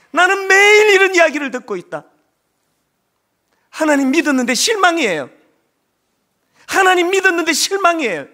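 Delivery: loud, high pleading cry, far above his speaking voice; anguished divine lament. Retold or imitated. Preacher voicing God's loud lament to the church, anguished cry. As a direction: cry out God's grief at full force.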